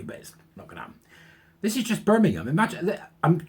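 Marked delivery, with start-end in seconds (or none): 0.0-0.9 a resigned whisper under the breath; whispered aside. Real, not acted. Vlogger conceding the council won't act, whispered. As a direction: drop it away as a whisper to yourself.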